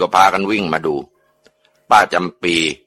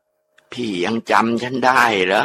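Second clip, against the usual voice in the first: ghost voice; 3 semitones higher, 4 dB quieter, much slower than usual. Acted. Dead wife asks her husband if he remembers her, slow eerie ghost voice. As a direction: drift each word out like a lonely spirit. Ghost speaks through possessed aunt.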